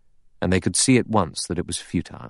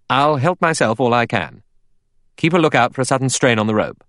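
higher, brisk man of action; crisp, raised-voice instructions; brisk Lombard; 6 semitones higher, 4 dB louder than usual. A narrator's reading. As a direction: give instructions briskly, pitched up. Voices Lombard giving orders.

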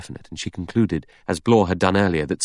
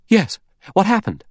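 Vera alarmed; 13 semitones higher, 5 dB louder than usual. A narrator's reading. Vera answers from her room.

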